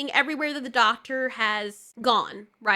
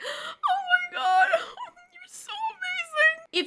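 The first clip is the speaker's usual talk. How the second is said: high, gasping mock sob over a compliment; exaggerated mock weeping, pitched way up; mock crying ex; slower than usual. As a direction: ham up a blubbering boyfriend, squeaky and teary. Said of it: "He would cry for everything".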